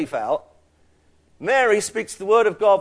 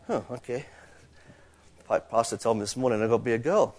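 low, subdued mock reply; reluctant acceptance; 7 semitones lower, 5 dB quieter, faster than usual. Men in the room told to be a girl, quietly reluctant. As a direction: drop it softer and lower, imitating a reluctant man.

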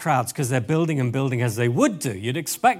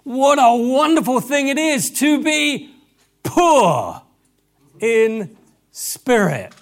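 high, loud, drawn out slowly; joyful proclamation; 6 semitones higher, 6 dB louder, slower than usual. Acted. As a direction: proclaim it like a glad surprise. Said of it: "He says".

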